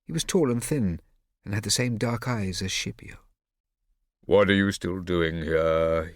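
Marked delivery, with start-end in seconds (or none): 4.2-6.1 slow, low, bored father. A narrator's reading. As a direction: drag it out, utterly bored. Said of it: "he said with a bored voice".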